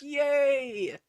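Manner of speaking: high, loud "Yay!"; thrilled little cheer; excited cheer. Acted. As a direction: squeal with joy. Cheery exclamation about the trip.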